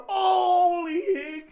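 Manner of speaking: high, long-drawn exclamation; excited outburst. Retold or imitated. Fan reliving his moment on screen, high excited outburst. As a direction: cry out high and stretch it, thrilled.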